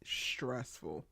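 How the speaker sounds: breathy whispered word; whispered aside. Real, not acted. Reacting to Jordan forgetting Khalil, whispered. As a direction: murmur it under your breath.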